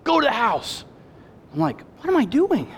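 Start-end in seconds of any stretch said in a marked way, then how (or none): 0.0-0.8 high mock yell at moderate volume; half-voiced angry parental shout; retold scream. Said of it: "And I'm screaming". 2.0-2.7 high-pitched, incredulous question to yourself; mock disbelief at your own outburst; retold self-reproach.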